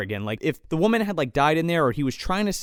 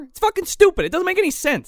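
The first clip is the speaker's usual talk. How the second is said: exasperated outburst; 12 semitones higher, faster than usual. Real, not acted. Ranting about the plot.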